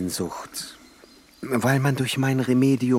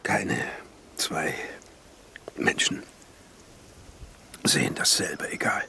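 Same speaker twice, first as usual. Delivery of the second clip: whisper; 4 semitones lower, 5 dB quieter, faster than usual. Acted. Alchemist repeats his inner motto.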